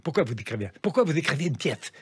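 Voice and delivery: lisping producer voice; slushy lisp. Retold or imitated. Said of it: "un producteur qui zozotait".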